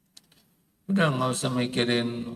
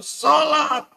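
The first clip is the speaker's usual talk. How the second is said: shouted punch word; 11 semitones higher than usual. Real, not acted. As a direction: hammer the word home to the crowd.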